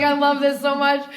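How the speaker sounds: bubbling over with delight; delighted gush. Retold or imitated. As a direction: relive the thrill of it. Retells own delighted reaction.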